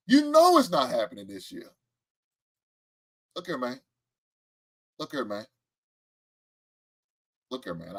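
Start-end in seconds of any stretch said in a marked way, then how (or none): 3.3-8.0 much softer, low appeal; quiet. Real, not acted. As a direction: say it nearly under breath. Repeated soft appeal.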